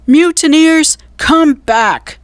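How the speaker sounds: high, loud bellow; furious shout. A narrator's reading. Pirate captain shouts at his fleeing men. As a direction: bellow after the fleeing crew. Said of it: "shouted Cap'n Bones".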